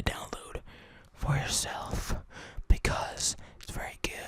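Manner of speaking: hushed joking sales pitch; whispered plug. Pretend.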